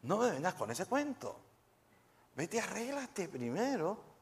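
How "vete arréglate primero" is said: soft, high, slow rebuke as Jesus; stern command. Acted. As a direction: send them away firmly. Jesus voiced rebuking worshipper.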